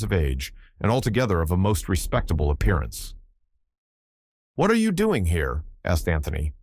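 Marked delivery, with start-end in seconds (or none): 4.6-5.6 sharp challenge. A narrator's reading. Anthony surprises hidden stranger.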